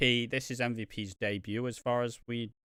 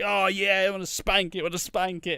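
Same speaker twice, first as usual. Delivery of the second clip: mock eager Miz; 5 semitones higher, 4 dB louder than usual. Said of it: "And then it's like".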